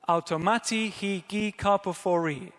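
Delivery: Automati hi gi karpophori, each word sounded out with a lilt; Greek phrase read aloud. Shown as a demonstration. Preacher reading the Greek verse to the congregation, lilting. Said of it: "pronounced like this".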